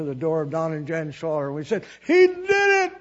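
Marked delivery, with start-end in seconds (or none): 2.0-2.9 much higher, loud exultant cry; joyful shout. Retold or imitated. Couple bursting in to tell Don and Jan, joyful shout. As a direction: burst in with the news. Bursting into friends' door rejoicing.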